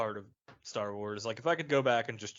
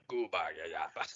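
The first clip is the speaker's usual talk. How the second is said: low alien gibberish, laughing; Jabba gibberish; 7 semitones lower, 3 dB quieter than usual. Pretend. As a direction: babble in Huttese like Jabba. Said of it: "it's just Jabba speak".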